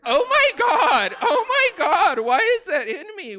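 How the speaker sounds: high, very loud, drawn-out wail; hammed-up anguished self-reproach; horrified outburst. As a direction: cry out in mock horror at yourself. Horrified at own outburst to niece.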